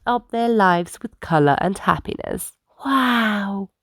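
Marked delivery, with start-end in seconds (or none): 2.8-3.7 soft, awed wow. A narrator's reading. Bus learning of new purpose, soft awe. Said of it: "thought J J".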